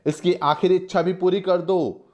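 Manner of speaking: mock him with glee; acted gloating, no audible laugh. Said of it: "Guman Singh हंसकर कहता है".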